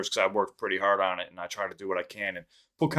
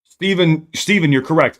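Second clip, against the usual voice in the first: excited callout; 6 semitones higher, 8 dB louder than usual. Real, not acted. Calling out a chat name.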